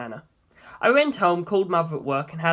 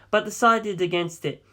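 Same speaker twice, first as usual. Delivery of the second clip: ordinary delivery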